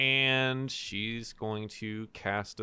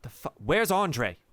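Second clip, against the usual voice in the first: angry demand; 7 semitones higher, 5 dB louder than usual. Acted.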